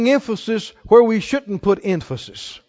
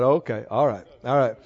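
murmured surrender; 9 semitones lower, 7 dB quieter than usual. Retold or imitated. Yielding to the Spirit.

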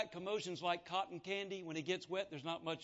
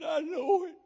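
put-on drunk man; 9 semitones higher, 11 dB louder than usual. Drunk man answering the preacher.

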